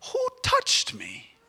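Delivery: high, slow wondering question; Jesus turning in the crowd, high and slow; surprised question. Acted. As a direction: ask it up high, drawn out.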